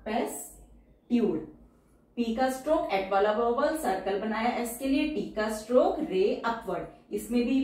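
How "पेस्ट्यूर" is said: पेस्ट्यूर said slowly and evenly; slow teacher dictation. Shown as a demonstration. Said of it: "teacher says word then dictates".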